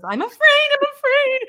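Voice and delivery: high squeaky mock fear; mock scared squeak. Mocking people as scared wusses, squeaky and high. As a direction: play a trembling wuss for laughs.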